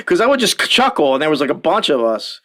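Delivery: ordinary delivery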